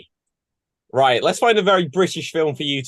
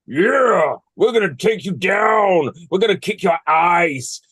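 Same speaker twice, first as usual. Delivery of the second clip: menacing rivals; 3 semitones higher, slower than usual. Acted. Bigger rivals threaten the kids, drawling menace. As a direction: taunt like the big rival team.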